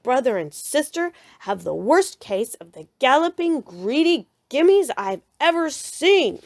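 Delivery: loud, angry fatherly rant; Papa venting to Mama, loud angry roar. A narrator's reading. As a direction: roar out your outrage at the cubs. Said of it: "he roared".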